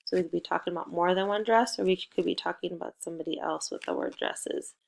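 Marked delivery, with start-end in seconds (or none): none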